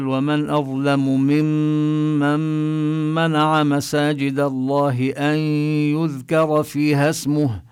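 very slow recitation, nun merged into mim; Quran recitation. Shown as a demonstration. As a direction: chant slowly, holding the ghunna. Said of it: "ومثل". (تلاوة بطيئة مجودة)

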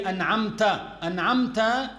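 "an'amta" ending on an a vowel; fatha ending. Shown as a demonstration. Teacher showing the correct vowel mark, fatha ending. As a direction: finish "an'amta" clearly on -ta. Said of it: "the accents for the letters".

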